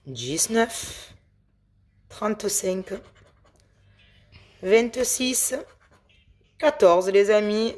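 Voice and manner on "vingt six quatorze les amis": normal talking voice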